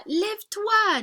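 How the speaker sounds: loud, slow, high order to get up; commanding. Shown as a demonstration. Teacher shouting lève-toi at a child who will not get up. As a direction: bark lève-toi slowly like waking a sleepy child.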